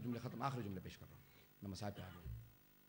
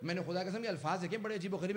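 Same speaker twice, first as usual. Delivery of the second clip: ordinary delivery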